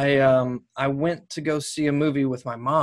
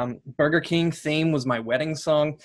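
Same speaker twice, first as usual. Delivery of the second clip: ordinary delivery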